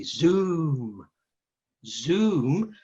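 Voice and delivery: Zoom drawn out slowly, one at a time; slow mantra. Acted. Crow showing the sheep how to chant slowly. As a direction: let each Zoom hang and settle. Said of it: "Say it slowly".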